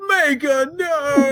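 high, drawn-out wail; mock anguished scream. Retelling Sebastian falling to his knees in horror, melodramatic scream. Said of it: "falls to his knees and screams".

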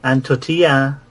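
hammed-up Spanish voice; Spanish accent. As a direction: list foods in a put-on Spanish accent. Continues the mock Spanish persona.

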